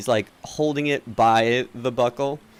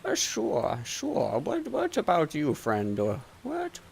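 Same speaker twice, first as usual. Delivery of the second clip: softer, swooping higher merchant voice; doddery shopkeeper, obliging; 6 semitones higher, 7 dB quieter than usual. Acted. Shopkeeper asking the other friend what they would like, obliging. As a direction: fumble politely toward the next customer. Shopkeeper asks second customer.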